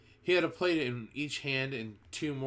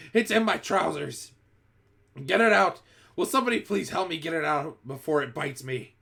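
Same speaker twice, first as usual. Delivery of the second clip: higher, loud frantic shout; 5 semitones higher, 6 dB louder than usual. A narrator's reading. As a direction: shout in panic for help. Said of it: "the stupid cook still shouting".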